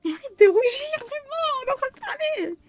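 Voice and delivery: high-pitched fangirl squeal; excited squeal. Real, not acted. Squealing at blushing character.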